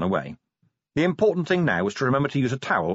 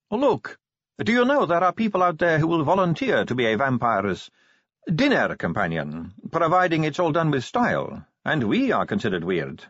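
high-pitched female character making a case; Sally, wry vampire; 7 semitones higher than usual. Acted. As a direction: argue wryly in a raised pitch. Sally compares their conditions.

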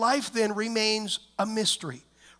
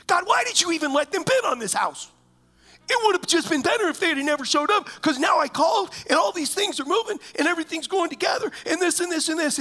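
hammed-up frantic complaint; frustrated outburst at God; 5 semitones higher, faster than usual. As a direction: vent at God, worked up and rushing. Venting at God on the beach.

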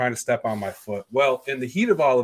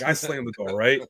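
usual speaking voice

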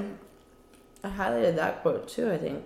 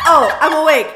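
mock startled yell; 7 semitones higher, 11 dB louder than usual. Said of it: "before she's like".

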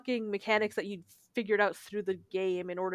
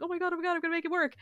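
mock nerves; 7 semitones higher, much faster than usual. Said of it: "really nervous".

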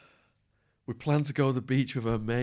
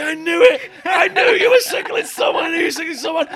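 high, loud accusing yell; loud triumphant yell; paranoid shout; 14 dB louder than usual. Retold or imitated. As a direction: shout like you've caught him out. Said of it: "He's like".

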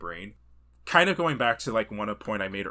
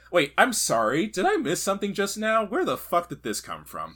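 higher, louder incredulous mock disbelief; mock bafflement; 10 semitones higher, 7 dB louder than usual. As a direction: act out being totally thrown. Retold baffled reaction in cinema.

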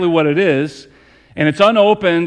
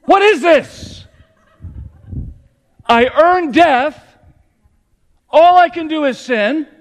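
impassioned preaching; 9 semitones higher than usual. Acted. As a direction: cry it out as the sermon's peak. Sermon climax.

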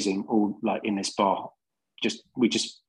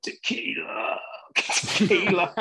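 mock drunken slur; 7 semitones higher, much slower than usual. Drunk in hospital, retold answer.

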